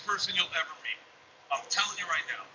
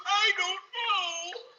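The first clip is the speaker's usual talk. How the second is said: put-on high, drawn-out little-boy wail; imitated crying child; 3 dB louder, much slower than usual. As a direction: wail it slowly, stretching the last word. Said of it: "freaking out, crying".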